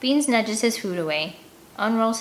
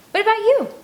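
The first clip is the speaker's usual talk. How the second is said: put-on high, louder girl asking; high girlish question, louder; girl's voice; 7 dB louder than usual. A narrator's reading. Scarlet voiced by narrator.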